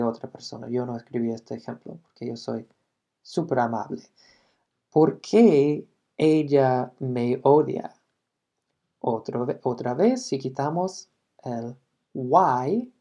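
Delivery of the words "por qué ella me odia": slow, louder Spanish model; slow, louder Spanish translation of the example. Shown as a demonstration. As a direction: say it slowly and clearly to the class. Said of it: "Otro ejemplo".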